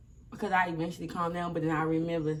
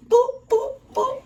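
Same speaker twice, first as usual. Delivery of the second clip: high, loud "boop" punches; rapid high hit noises; punching sound effect; 9 dB louder, much slower than usual. Retold or imitated. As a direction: fire off high-pitched mock blows. Acting out hitting her.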